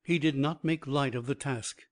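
ordinary delivery, nothing marked

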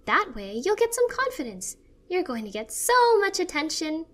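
high, loud, excited sister voice; Phoebe. A narrator's reading. Sister pitching an Instagram page, high and eager.

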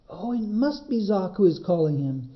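usual speaking voice

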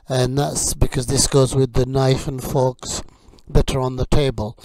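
usual speaking voice

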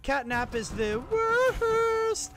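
slow, drawn-out whine on "worst"; exasperated wail. Real, not acted. Exasperated complaint about the game enemy.

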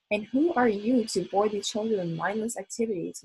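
ordinary delivery

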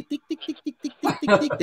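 high, soft ticking steps; light clicking patter; tiny footsteps. Retold or imitated. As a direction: tap out a little kid's quick steps. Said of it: "un enanito así".

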